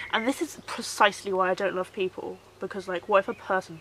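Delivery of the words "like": ordinary talk, nothing marked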